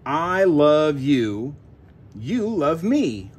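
ordinary delivery

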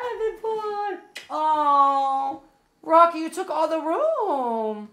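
high, drawn-out cooing; doting dog-owner voice. Real, not acted. Dog dad to pretty big boy, high cooing.

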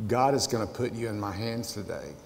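put-on young David to Goliath, quiet resolve. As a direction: say it low with calm certainty. David answering the giant.

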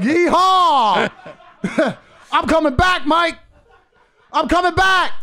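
high, loud, laughing whoop; triumphant cowboy whoop through laughter; gleeful taunt. Retold or imitated. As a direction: crow it out like you've just won.

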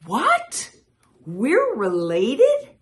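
high, loud disbelief, slowing down; shocked. A narrator's reading. Frogs and toads learning they are related, high loud shock. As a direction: shriek the first word, then stretch the question.

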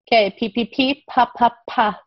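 put-on crisp, popped P sounds for a child; sounding out P. Shown as a demonstration. Teacher modelling the P sound for a young student. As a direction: pop each P, then say pa. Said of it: "The letter P stands for the P sound".

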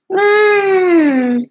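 strained high whimpering cry. Real, not acted. Upset youtuber whimpering over hate comments. Distraught over bad video.

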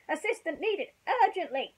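high, loud military plea; urgent soldier call. Acted. Green Army men calling for rescue, loud and high. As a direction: call for backup.

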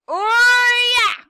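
high, loud oh; excited shout. Real, not acted. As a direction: yell it as it clicks. Sudden discovery.

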